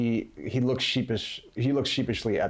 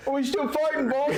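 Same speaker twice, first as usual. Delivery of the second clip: high laughing henchman voice. Acted. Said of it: "you hear him going".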